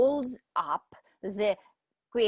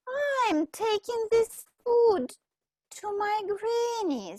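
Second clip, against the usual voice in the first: put-on much higher, small girl's voice; little girl voice; 10 semitones higher than usual.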